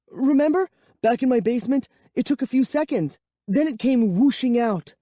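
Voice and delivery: high, nervous and loud; high and shaky. Acted. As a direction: bluff calm while scared. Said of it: "my voice came out high and shaky".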